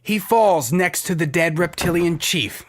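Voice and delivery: normal talking voice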